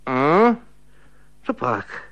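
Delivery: a hint of a drowsy old spinster; a lightly voiced woman waking up, mumbling; half-asleep woman's grunt; sleepy woman's mumble. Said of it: "zabrebentil ženský hlas".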